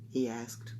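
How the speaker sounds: ordinary delivery, nothing marked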